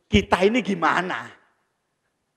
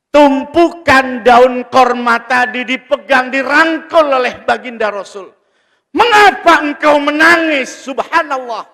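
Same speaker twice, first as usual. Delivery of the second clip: high, loud sermon cry; impassioned preaching; 8 semitones higher, 11 dB louder than usual. Real, not acted. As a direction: raise the voice and stir the congregation. Preaches at full force. (ceramah berapi-api, suara tinggi dan lantang)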